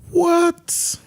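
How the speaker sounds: sudden high squeak of disbelief; shocked, voice jumps way up; surprised. Real, not acted. Host hears surprising box office figure.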